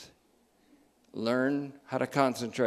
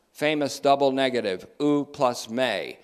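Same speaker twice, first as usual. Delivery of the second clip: usual speaking voice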